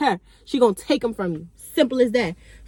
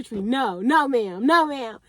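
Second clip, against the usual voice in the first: ordinary delivery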